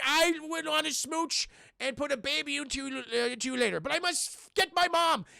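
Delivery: high, earnest hero voice; imitated high-pitched movie hero; mock-heroic lover. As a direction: declare it with hammed-up urgency. Hero tears himself from his love.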